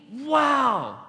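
high, drawn-out wow; amazed exclamation. Acted. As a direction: burst out in big amazement. Acts amazement at the miracle.

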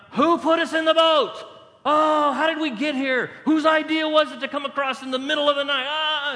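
high, loud hammed-up panic; panicked crying out. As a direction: freak out in a mock frantic yell. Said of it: "was probably crying out".

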